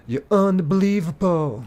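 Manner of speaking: high, drawn-out theatrical voice; exaggerated put-on exclamation. Streamer repeats a chat line for laughs, theatrical exclamation. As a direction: stretch it out high, hamming it up.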